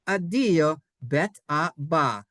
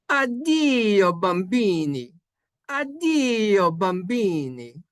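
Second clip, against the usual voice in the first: high, loud start falling away in Italian accent; Italian demonstration; 4 semitones higher, 4 dB louder, slower than usual. Shown as a demonstration. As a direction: sing out the Italian farewell, stretching the end. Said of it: "This is how you say".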